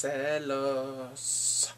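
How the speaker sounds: drawn-out Spanish "jealous", as "celos"; Spanish pronunciation. Real, not acted. Recapping Bongsu's mom, "jealous" stretched into Spanish "celos".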